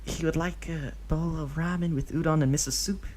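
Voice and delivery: high, put-on voice placing an order; character voice ordering. A narrator's reading. Obito ordering food for Deidara, high put-on voice. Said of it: "he tells the hostess".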